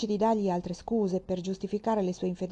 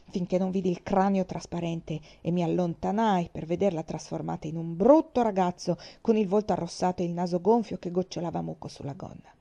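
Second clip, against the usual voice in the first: usual speaking voice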